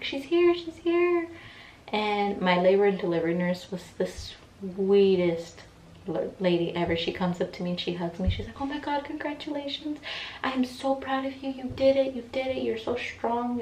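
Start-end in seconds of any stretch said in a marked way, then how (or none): 0.0-1.3 high-pitched retold cry of joy; excited announcement. Birth announced in acted quote. 8.6-13.6 high-pitched imitation of a thrilled nurse; gushing congratulations. Nurse congratulating her, acted.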